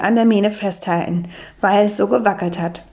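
ordinary delivery

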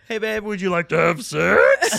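high, loud puppet voice, laughing; Muppet voice. Retold or imitated. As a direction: squeak it like a nervous Muppet. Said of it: "a Muppet voice all of a sudden".

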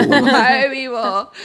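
slow, laughing delivery. Real, not acted. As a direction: laugh through the words. Laughing repeat.

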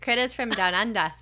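amateur Aussie accent on "Critters from Down Under"; botched Australian accent. Shown as a demonstration. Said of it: "how would you say that in an Australian?".

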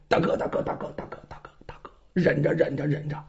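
hushed, breathless warning to the boss; urgent whisper. Acted. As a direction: whisper him back from trouble. Bandit urgently warns his boss. (压低声音急切耳语)

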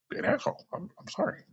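hushed mock-apologetic whisper; whispered aside. Vlogger whispering a jab at Trump fans, then a fake sorry. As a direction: slip the insult in under your breath.

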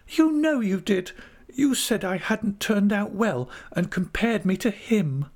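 very high-pitched retort; high, hurt accusation; accusing young wife. A narrator's reading. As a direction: throw it back in a high little voice. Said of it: "returned Dora".

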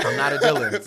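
very loud, high comic denial; loud, playful exasperation; mock protest. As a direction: holler the denial in mock outrage. Repeats mock protest, laughing.

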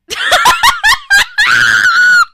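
loud, high shrieking laugh; explosive laughter. Real, not acted. Co-host seeing a funny post, shrieking with laughter. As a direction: burst into a long loud cackle.

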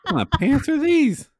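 high, loud incredulous outburst; shocked disbelief. Real, not acted. Friend hearing the pants price, shrieking in disbelief. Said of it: "shocked reaction to price".

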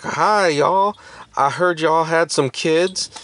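chirpy Southern drawl impression; Southern accent. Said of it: "doing an impression of her, uh, accent".

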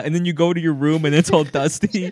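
ordinary talk, nothing marked

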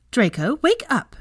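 loud, high, drawn-out command; sharp. A narrator's reading. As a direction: rouse a son sharply. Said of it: "his mother said more sharply".

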